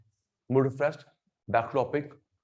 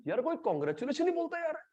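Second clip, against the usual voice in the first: high, soft whining complaint; hammed-up hurt whimper; mock whine; 11 semitones higher, 9 dB quieter than usual. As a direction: sulk like a hurt kid. Mock whining about no congratulations.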